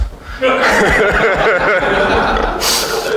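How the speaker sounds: a long real laugh at his own punchline; hearty laugh. Real, not acted. Laughing at the lighthouse punchline, real hearty laughter. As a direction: let the laugh burst out and run on.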